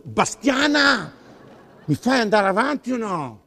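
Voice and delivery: comic outburst of impatience; exasperated shout. Acted. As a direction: yell at someone who keeps interrupting. Interrupted, scolds Bastiana by name.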